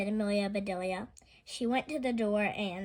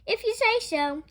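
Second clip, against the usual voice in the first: high, louder agreeable reply; obliging; 11 semitones higher, 7 dB louder than usual. A narrator's reading. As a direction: go along happily. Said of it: "said Amelia Bedelia".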